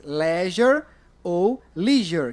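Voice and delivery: "leisure" said as "leh-zher", then as "lee-zher"; two pronunciations. Shown as a demonstration. Teacher to class, slowly contrasting two pronunciations. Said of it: "Essa palavra tem duas pronúncias".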